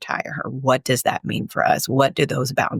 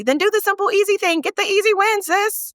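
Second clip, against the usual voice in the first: high playful hype; 13 semitones higher than usual. Real, not acted. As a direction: sing it out way up high, teasing.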